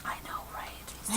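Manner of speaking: soft whisper. Real, not acted. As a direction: whisper it fondly. Whispered reply about cute toy.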